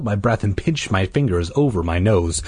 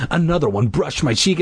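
ordinary delivery, nothing marked

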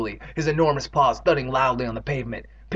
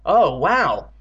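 louder, wide-eyed wow; amazed exclamation; 3 semitones higher, 5 dB louder than usual. Acted. Andy stares into the bucket, exclaiming amazed. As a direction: exclaim in astonishment. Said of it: "Andy exclaimed".

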